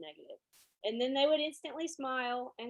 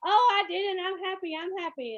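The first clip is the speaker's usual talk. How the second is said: much higher, louder mock protest; caught student denying; 9 semitones higher, 9 dB louder than usual. Caught student insisting they're happy, high loud denial. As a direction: squeal a guilty denial. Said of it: "And then they go".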